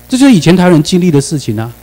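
ordinary talk

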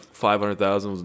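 ordinary talk

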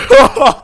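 shouted breathless laugh; loud laugh. Real, not acted. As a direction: burst out laughing. Laugh after gasping.

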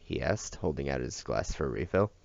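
ordinary delivery, nothing marked

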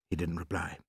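ordinary delivery

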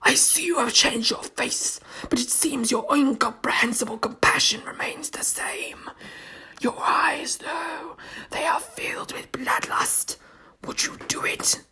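a sour, wet whisper of a monster; sour wet creature voice. Acted. Zygon voice held.

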